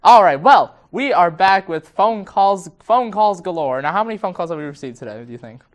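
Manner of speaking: loud, lively telethon host. Pretend. Hosts a telethon segment.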